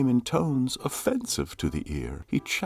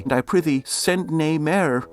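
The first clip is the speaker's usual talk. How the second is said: scornful lady; 6 semitones higher, 6 dB louder than usual. Acted. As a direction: shut him down with disdain. Lady rebuffs lover.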